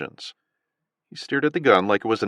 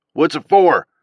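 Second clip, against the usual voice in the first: demanding southern voice; 7 semitones higher, 8 dB louder, slower than usual. A narrator's reading. Gunman demanding, far.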